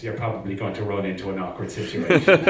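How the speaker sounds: usual speaking voice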